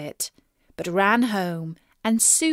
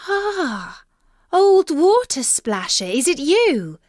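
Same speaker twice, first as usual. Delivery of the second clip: startled princess; 7 semitones higher, 5 dB louder than usual. Acted.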